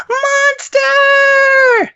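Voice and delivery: put-on high, loud childish scream; screaming kid. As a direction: shriek it like a panicked kid. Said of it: "he screams at the top of his lungs".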